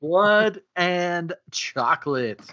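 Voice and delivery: slow, weighty reading of "Blood and Chocolate"; slow dramatic title read. Acted. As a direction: drag out "Blood and Chocolate" ominously. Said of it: "slow dramatic title read".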